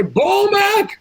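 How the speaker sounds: high, slow booming command; commanding shout. Acted. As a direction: bellow it like a tyrant king. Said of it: "and I say".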